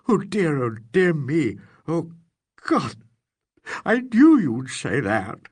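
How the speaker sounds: a frightened man breaking down into tears. Acted. Legg breaking down in tears before Alan. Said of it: "And broke into tears".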